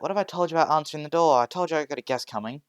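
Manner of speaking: loud, fast, exasperated telling-off; scolding big sister. Acted. Older sister scolding her little sister, loud and fast. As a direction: snap at the kid for answering the door.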